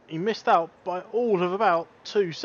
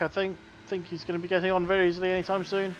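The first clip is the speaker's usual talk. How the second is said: ordinary delivery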